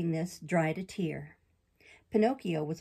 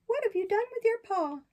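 high, bright-eyed puppet, much higher than the narrator; puppet boy voice. Acted. Pinocchio protests to the fairy in a high boyish voice. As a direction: play Pinocchio as an eager young boy defending himself.

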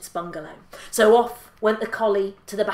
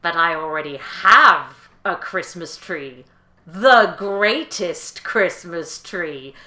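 louder, lower, grand peacock voice; proud peacock; 4 semitones lower, 7 dB louder, slower than usual. Acted. Peacock boasts to the collie about his tree, proudly. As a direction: announce it proudly, slowing at the end. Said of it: "And the peacock said".

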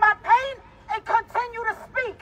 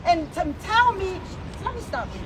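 ordinary talk, nothing marked

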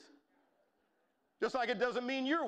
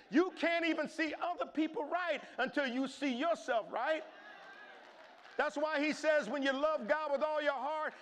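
preaching at full force; 5 semitones higher than usual. Acted. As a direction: preach it with rising fire.

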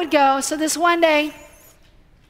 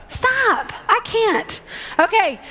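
ordinary delivery

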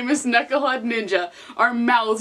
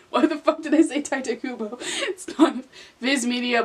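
laughing. Real, not acted. Laughing at own mistake.

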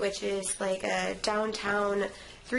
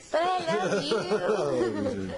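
laughing; much slower than usual. Real, not acted. Laughing affectionate reply.

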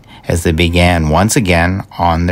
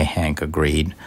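usual speaking voice

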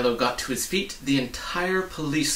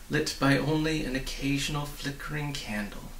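plain reading voice

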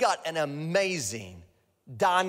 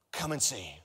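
soft repeat; 4 semitones lower, 5 dB quieter than usual. Shown as a demonstration. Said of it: "this is the title of your sermon".

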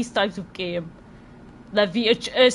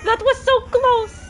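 panicked shrieks, much higher and louder; being chased; 14 semitones higher, 7 dB louder than usual. Real, not acted. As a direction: scream and laugh while fleeing. Fleeing the killer in game.

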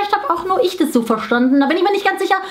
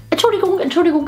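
ordinary talk, nothing marked

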